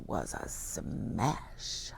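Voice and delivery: slow, hushed delight; whisper. A narrator's reading. Narrator whispers Milo's success. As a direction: whisper the good news.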